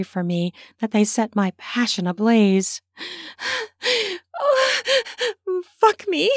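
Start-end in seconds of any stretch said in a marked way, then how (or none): 3.0-6.3 high, breathless gasped plea; between pants. A narrator's reading. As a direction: pant hard round the words. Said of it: "I said between my pants".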